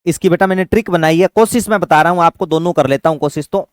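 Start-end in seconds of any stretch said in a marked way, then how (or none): none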